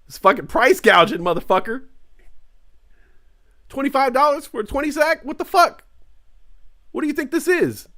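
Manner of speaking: outraged. Acted. Buyer ranting at dealer about price gouging. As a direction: rant at the rip-off.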